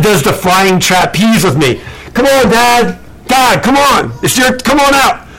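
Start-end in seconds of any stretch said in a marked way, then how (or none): 2.1-5.3 higher call to dad offstage. Acted. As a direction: beckon dad onto the stage. Calling dad onstage.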